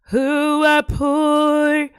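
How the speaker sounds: high, slow, whiny pity voice; mock pitiful. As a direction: drag out "poor" like a sad beggar. Self-pitying joke about being poor.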